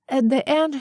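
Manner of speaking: plain reading voice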